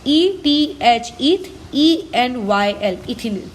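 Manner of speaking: slow, letter-by-letter dictation; spelling it out. Shown as a demonstration. Teacher spells the substituent's name for students, slow dictation. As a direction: spell it out slowly in chunks for note-takers.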